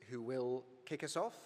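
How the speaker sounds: usual speaking voice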